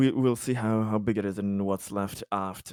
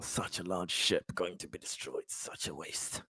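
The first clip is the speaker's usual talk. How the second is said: quiet lament; 4 semitones lower, 13 dB quieter than usual. Acted. Character mourning the doomed ship.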